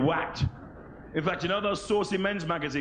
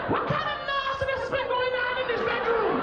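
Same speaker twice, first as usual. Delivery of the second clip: very high scandalised Jamaican mum; mum's voice. Retold or imitated. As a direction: shriek like an outraged mother. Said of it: "My mum would ... and go".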